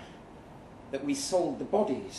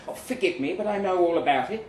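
veiled annoyance; 4 semitones higher, 7 dB louder, faster than usual. A narrator's reading. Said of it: "a vague snap of anger hidden beneath her smooth tone".

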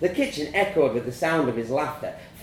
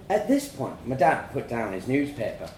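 plain reading voice

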